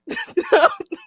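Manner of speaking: squealing high laugh; high burst of laughter. Real, not acted. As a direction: shriek of laughter.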